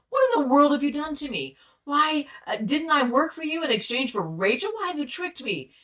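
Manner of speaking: acted incredulous protest; outraged accusation. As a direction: confront the man who tricked you. Jacob confronts Laban over trickery.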